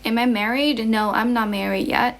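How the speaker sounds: normal talking voice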